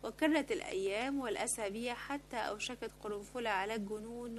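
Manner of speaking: usual speaking voice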